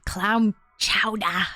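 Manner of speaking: put-on slow, drawn-out New England accent. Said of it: "you're in New England".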